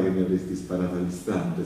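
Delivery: normal talking voice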